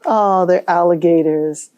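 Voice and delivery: normal talking voice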